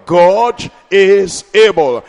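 slow, loud, stretched proclamation; drawn-out preaching. Acted. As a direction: lean on every word for the crowd. Preaching refrain.

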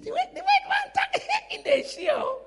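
mock him while laughing high; laughing mockery. Mocking the man's talk, laughing.

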